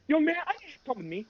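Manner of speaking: high, hurried summons; fast, high-pitched mock authority; imitated assistant principal. Assistant principal to student, urgent mock summons.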